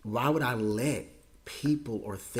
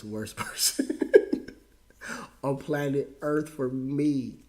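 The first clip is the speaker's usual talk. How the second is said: laughing through tears; 3 semitones higher, 3 dB louder, slower than usual. Real, not acted.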